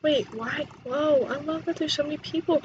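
normal talking voice

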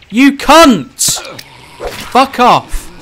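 high, loud cursing; sharp outburst of gamer rage; angry shouting. Real, not acted. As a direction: yell abuse at the enemy. Cursing game enemy.

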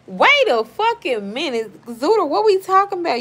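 loud, high-pitched indignant challenge; shocked outburst. Real, not acted. Streamer confronts commenter over a diss, outraged. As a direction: burst out, demanding an explanation. Suddenly realises a comment disses her.

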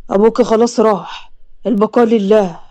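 low voice of a man announcing a death; blunt bearer of news. Acted.

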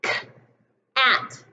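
k-at split onset and rime; segmented sounds. Shown as a demonstration. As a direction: sound out k then at. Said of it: "what about".

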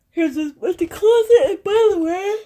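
mock sobbing; mock wail. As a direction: pretend to sob over the empty closet. Fake cry at empty closet.

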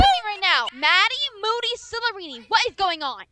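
loud scolding; furious parent shouting; angry mother. Acted. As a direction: demand it, fuming. Mother yelling at daughter.

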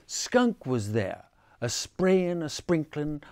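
ordinary delivery

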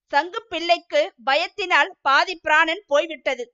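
ordinary delivery, nothing marked

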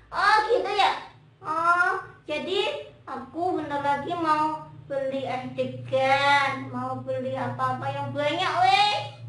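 loud puppet glee; Momon. Pretend. Momon plans treats for breaking fast, excited puppet voice. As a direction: gush eagerly in a singsong puppet voice.

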